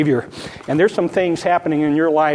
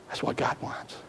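even fainter whisper, leaning on God; 13 dB quieter than usual. Real, not acted. Pastor repeating to the congregation, fainter whisper. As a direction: breathe it, almost silent.

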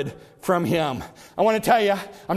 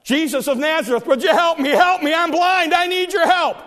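high, urgent cry for help; desperate beggar calling over a crowd; Barnabas; 7 semitones higher than usual. Retold or imitated. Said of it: "And so Barnabas cries out".

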